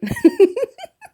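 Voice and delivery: high, loud burst of giggles; real helpless giggle; amused giggle. Real, not acted. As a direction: crack up in a high-pitched laugh. Laughs at her own attempts.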